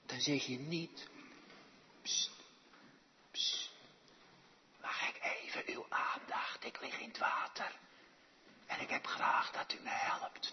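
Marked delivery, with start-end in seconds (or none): 2.0-10.5 timid, hushed whisper for attention. Acted. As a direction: whisper a far too polite plea for help. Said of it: "Maar ze fluistert niet". (beleefd fluisterend om aandacht vragen)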